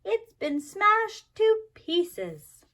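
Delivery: put-on high, loud, slow upset child; slow loud lament; upset shout. A narrator's reading. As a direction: drag it out, heartbroken and loud. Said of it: "shouted little Chan".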